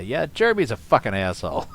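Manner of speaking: ordinary talk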